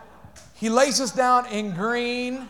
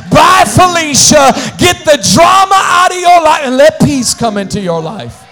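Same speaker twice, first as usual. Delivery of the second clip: loud, higher shouted rebuke, half sung; full-force preaching; 4 semitones higher, 12 dB louder than usual. Acted. Pastor calling his church to cut out drama, shouting. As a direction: preach it hard and let the crowd ride it.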